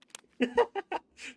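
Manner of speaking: high, loud burst of laughter; loud laughing burst. Real, not acted. Gamer laughing at his own yelp, loud laughter. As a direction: crack up at himself.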